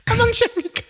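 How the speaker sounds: laughing through it. Real, not acted. Laughing at being scammed.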